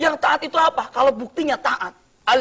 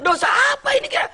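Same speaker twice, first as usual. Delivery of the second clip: anxious question; 3 dB louder than usual. Acted. Imam asks teacher anxiously.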